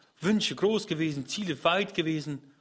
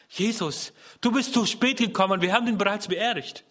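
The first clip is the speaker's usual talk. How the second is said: higher, louder grieving reproach; anguished lament; 6 semitones higher, 4 dB louder than usual. Acted. Martha reproaching Jesus for her dead brother, anguished lament. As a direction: cry it out as a grieving sister.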